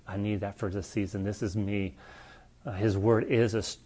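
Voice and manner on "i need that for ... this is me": quiet inner voice. Retold or imitated. Voicing a believer's inner reply.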